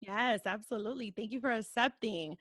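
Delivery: normal talking voice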